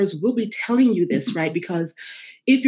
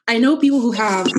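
crying; 4 semitones higher, 6 dB louder than usual. Real, not acted. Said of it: "I'm sorry I'm crying".

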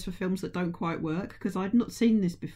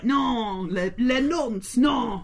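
high, drawn-out mock French protest; hammed-up slow French refusal; mock French children; 5 semitones higher, much slower than usual. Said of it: "they're just like".

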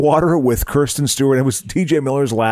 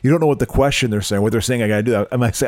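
ordinary talk, nothing marked

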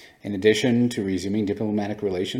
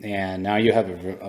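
ordinary delivery

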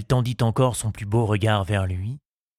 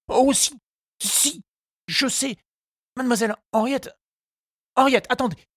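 very high, agitated voice in short bursts; desperate suitor. A narrator's reading. Charles begs Henriette to wait, desperate. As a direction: call after her, voice pitched right up.

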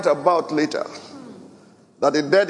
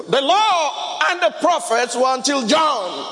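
booming scripture cry; proclaiming shout; 6 semitones higher than usual. Real, not acted. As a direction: ring it out from the pulpit.

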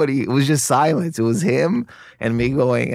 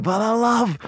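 heckler yell; 11 semitones higher than usual. Retold or imitated.

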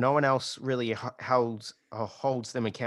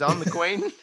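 higher, louder campy queen; drag queen voice; 6 semitones higher, 3 dB louder than usual. Retold or imitated. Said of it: "He turns up in drag. He says".

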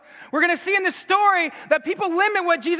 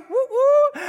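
mock cheer; 10 semitones higher, 4 dB louder than usual. Real, not acted. Cheering about teaching New Testament.

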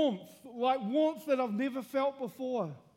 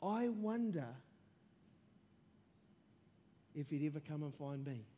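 small, soft, low inner wondering; little wee thought; 6 semitones lower, 7 dB quieter than usual. Retold or imitated. Young man thinking to himself during the sermon, small and soft. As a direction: let it drop away, soft and hesitant. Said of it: "I just had this little wee thought".

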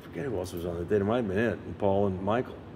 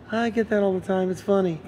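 much higher, light mock impression of his voice; Michael's voice; 10 semitones higher than usual. Michael calling him on the phone, imitated high.

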